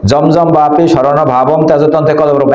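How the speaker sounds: verse run straight on without a break; run together. Shown as a demonstration. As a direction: rattle off "জমজম বাপি শরণ ভাবম তেজতন্ত্রে কলবরম" as one unit. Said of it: "অনেকে এইভাবে পড়ে".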